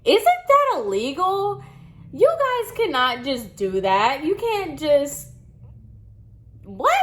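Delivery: high-pitched, indignant disbelief; retold shocked protest, pitch jumping up; incredulous outrage. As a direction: react as if scandalized by the news. Retold outrage at the hospital mix-up.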